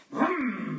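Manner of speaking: engine vroom made with the voice; mock engine roar; motorbike impression. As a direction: rev it like a motorbike. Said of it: "juggling five balls on a very fast motorbike".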